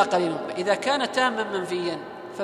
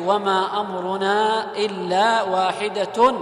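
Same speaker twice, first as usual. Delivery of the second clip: slow, drawn-out chanted recitation; Quranic recitation; 2 semitones higher, 5 dB louder, much slower than usual. Shown as a demonstration. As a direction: recite the verse slowly with tajweed. Teacher recites verse slowly. (تلاوة قرآنية بطيئة)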